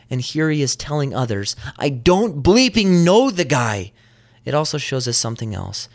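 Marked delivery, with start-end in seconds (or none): 1.8-3.9 high, slow, emphatic mock outburst; acted cursing denial. Peter telling others he disowns Jesus, mock cursing denial. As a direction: stretch out the censored curse in retold exasperation.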